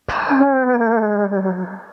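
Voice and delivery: rolling purr like a cat. Shown as a demonstration. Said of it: "and say".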